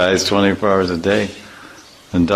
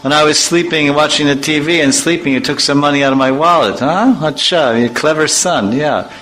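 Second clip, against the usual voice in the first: high mocking father voice; a father catching his son; teasing father; 6 semitones higher than usual. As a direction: tease the son for being clever. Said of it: "if someone says you're chatur".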